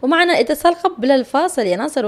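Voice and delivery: ordinary delivery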